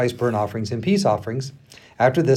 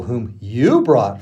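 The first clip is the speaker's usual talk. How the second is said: "you" punched hard and held; pointed, drawn-out emphasis on the word "you"; stress on YOU; 3 semitones lower, much slower than usual. A narrator's reading. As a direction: lean heavily on "you", passing the blame back. Moses hands blame back, laughter follows.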